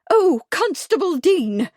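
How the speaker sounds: high, loud fussy lady calling out; shrill cry. A narrator's reading. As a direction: announce yourself shrilly from the doorway. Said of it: "a woman's voice cried shrilly".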